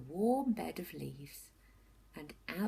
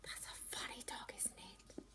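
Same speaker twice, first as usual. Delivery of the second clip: whisper; 2 semitones higher, 14 dB quieter than usual. A narrator's reading. Aside whispered to listeners.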